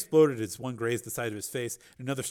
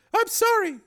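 high, hurt apology; tearful woman; 9 dB louder, slower than usual. Acted. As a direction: apologise high, close to tears.

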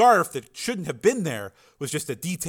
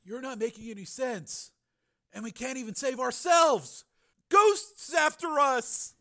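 haunted despair; 11 semitones higher than usual. A narrator's reading. Crazed brother to Frank, haunted despair.

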